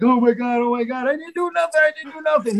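mock trembling protest of innocence; imitated terror. Ed terrified, pleading he did nothing wrong. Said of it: "He's shaking".

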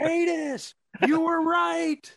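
very high, loud, slow wail; joking about the promoter sucked into the storm, hammed-up wail; mock dramatic wail. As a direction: cry it out as if swept away. Imagined last words in tornado.

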